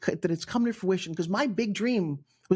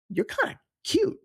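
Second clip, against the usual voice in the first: high, tentative self-compliment; a coy, high-pitched inner voice discovering something; pleasantly surprised; 13 semitones higher than usual. Retold or imitated. Voicing thought to her reflection.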